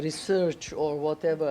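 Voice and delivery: usual speaking voice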